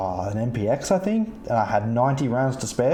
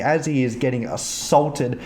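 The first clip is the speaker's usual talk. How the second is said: ordinary delivery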